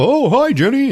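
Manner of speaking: very high mock greeting; cheery skater girl. Girl greeting people as she skates, mock cheer. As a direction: chirp it high, imitating a happy girl.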